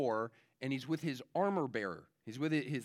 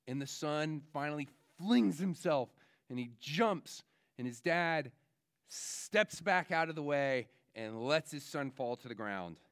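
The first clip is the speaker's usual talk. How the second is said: ordinary delivery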